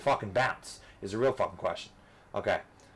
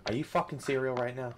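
ordinary talk, nothing marked

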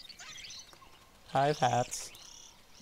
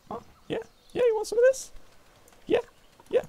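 high taunt; 6 dB louder, faster than usual. Pretend. Taunting creature in game.

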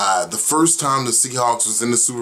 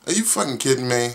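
ordinary delivery

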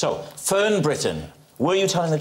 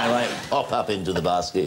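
lightly voiced comedian impression, low pitch; a low-pitched hint of a famous comic; Ronnie Corbett; 7 semitones lower than usual. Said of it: "I'm doing Ronnie Corbett".

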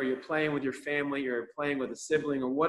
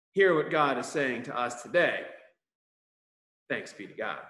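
ordinary talk, nothing marked

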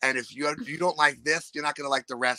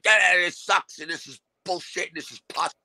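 higher, griping uncle, lightly voiced; mock loudmouth uncle; 8 semitones higher than usual. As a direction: complain about everything like an obnoxious uncle. Voicing loudmouth uncle at Thanksgiving.

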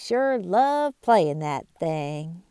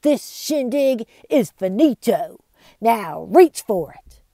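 sneering; 2 semitones higher, 8 dB louder than usual. Acted. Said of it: "Bart sneered".

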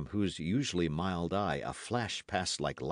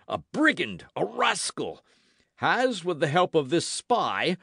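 high, forceful blustering musketeer; indignant Porthos; 13 semitones higher, 8 dB louder than usual. A narrator's reading. Porthos rails at Aramis about the cardinal's spy, indignant. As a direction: build an outraged tirade in a raised voice.

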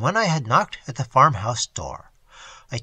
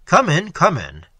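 hearty welcome; 3 semitones higher, 6 dB louder than usual. A narrator's reading. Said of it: "repeated his".

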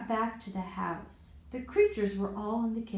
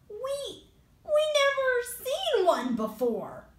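tub monster. Acted. Tub monster answers solemnly.

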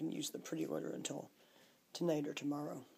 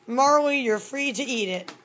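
loud resigned; 8 semitones higher, 16 dB louder than usual. Real, not acted. Giving up on the cat.

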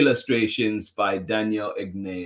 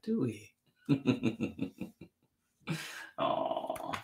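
ordinary delivery, nothing marked

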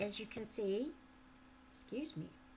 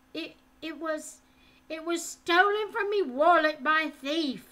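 higher, louder stammering excuse; frightened boy; 7 semitones higher, 10 dB louder than usual. A narrator's reading. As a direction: plead while tripping over words.

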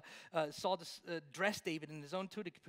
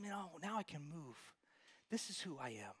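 relieved and quiet; 4 semitones lower, 9 dB quieter than usual. Retold or imitated. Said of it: "He says".

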